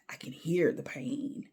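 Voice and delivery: unvoiced, hushed aside; a soft whispered I can hear the pain. Real, not acted. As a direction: breathe it sympathetically. Sympathetic aside.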